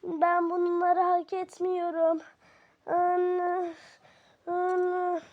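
slow, drawn-out weepy lament; tearful moaning. Acted. Sister crying for mother over what happened, tearful whine. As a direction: moan it through tears.

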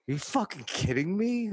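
high-pitched incredulous question; incredulous, as if to oneself; retold disbelief. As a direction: replay stunned exasperation, pitch soaring. Retells his disbelief walking away.

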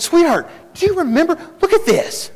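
excited husband calling out to his wife. Retold or imitated. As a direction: rush to show her the find.

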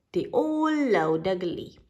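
plain reading voice

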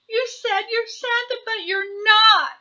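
acted tearful protest from little hare; wailing. A narrator's reading. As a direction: wail the accusation like a toddler. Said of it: "he wailed".

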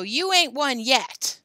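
slow, loud, high retort; yelled. A narrator's reading. Joe tells Billy the bet goes on, slow yell. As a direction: yell it back deliberately. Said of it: "yelled Joe".

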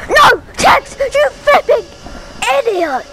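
high, loud furious yell at a teammate; outraged shout of no, then insult. Real, not acted. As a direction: scream at him for ruining the play. Teammate Tex blunders in game.

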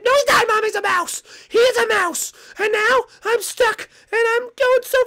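hammed-up kid meltdown; upset child. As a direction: argue back at mom, shouting. Child argues angrily with mom.

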